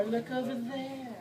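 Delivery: soft, slow sneaky aside; whisper-steer them away; hushed distraction. Pretend. To the man staring, a soft slow redirect.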